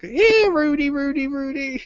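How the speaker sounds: very high, sing-song taunt; Buck taunting. Retold or imitated. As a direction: call it out teasingly. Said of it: "Buck just like".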